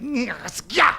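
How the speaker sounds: high, loud mock grunt; grunting nyah. Skinhead grunts as he hits the posh man. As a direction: grunt as you strike.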